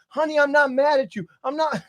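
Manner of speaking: high, softened retold soothing; pleading reassurance. Dad on toilet to crying daughter, high soft reassurance. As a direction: coax an upset child in a high voice.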